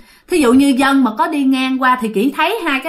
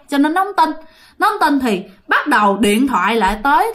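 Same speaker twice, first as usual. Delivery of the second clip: ordinary delivery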